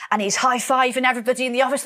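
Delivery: ordinary talk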